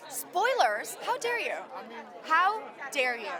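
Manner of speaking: playfully scandalized, pitch swooping up; hammed-up indignation; mock outrage. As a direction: act offended at the very idea, drawing out the last words. Mock outrage at being asked spoilers.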